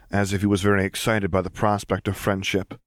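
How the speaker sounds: ordinary delivery, nothing marked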